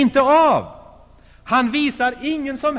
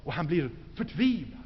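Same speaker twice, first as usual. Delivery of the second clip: usual speaking voice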